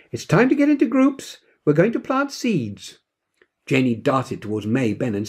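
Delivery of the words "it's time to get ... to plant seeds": high teacher's call across the garden. A narrator's reading. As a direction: call the class together from afar. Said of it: "voice called across the garden".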